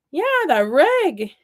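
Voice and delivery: high, slow Wisconsin "reg"; Wisconsin accent. Retold or imitated. Wisconsin guy confirms to puzzled counselor, Wisconsin accent. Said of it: "he's like".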